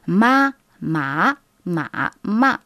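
"Ma, ma, ma, ma" with level, rising, dipping and falling tones; four tones drill. Shown as a demonstration. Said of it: "une technique ... pour maîtriser les quatre tons".

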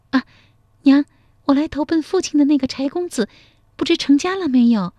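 put-on high, quick young woman's voice; shy daughter. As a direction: ask it high and fast, covering shyness. Daughter shyly asks about suitor.

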